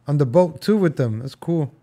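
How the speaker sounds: usual speaking voice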